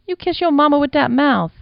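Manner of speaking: scolding madam. Acted. The madam mock-scolding her bold lover, high and loud. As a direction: playfully scold a cheeky boy.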